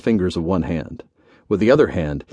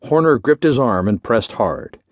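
ordinary delivery, nothing marked

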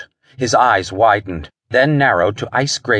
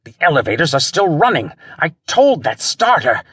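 high, exasperated outburst; cursing; 6 semitones higher than usual. A narrator's reading. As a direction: fume at the starter's failure. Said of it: "he halted and cursed".

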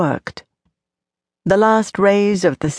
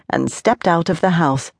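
plain reading voice

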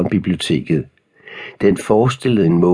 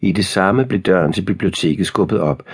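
ordinary delivery

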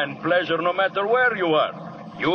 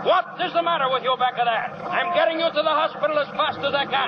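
higher, fast yell over the engine; shouting back; 10 semitones higher than usual. Acted. Driver yelling back at the wounded men, high and hurried.

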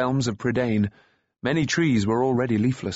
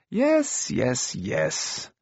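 Dallben; much slower than usual. A narrator's reading. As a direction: cut someone off, brushing them aside. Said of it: "Dallben interrupted with a wave".

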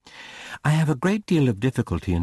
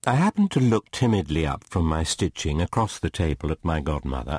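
plain reading voice

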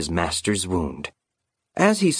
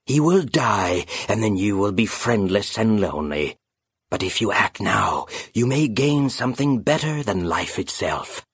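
eerie, cold phantom voice, coaxing; a ghostly bird tempting its prey; sinister phantom bird. Acted. Phantom bird tempting the grieving raven, eerie and cold.

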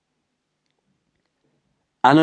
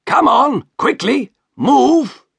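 higher, louder, impatient shove of words; angry order; 13 semitones higher, 3 dB louder, slower than usual. Acted. Shopkeeper hurrying Merrick across the room, angry and loud. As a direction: drive him across the room. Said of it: "said the shopkeeper angrily".

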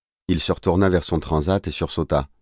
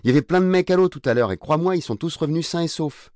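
put-on much higher, heated teenage argument; pleading protest; 11 semitones higher than usual. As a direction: argue back urgently with your father. Fils qui proteste contre l'interdiction. (protestation vive, très aiguë)

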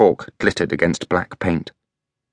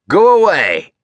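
higher, louder stern command; harsh woman; 12 semitones higher, 6 dB louder, slower than usual. A narrator's reading. Freya ordering Elliot away, harsh and loud. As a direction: drive the boy off sharply. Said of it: "she said to Elliot".

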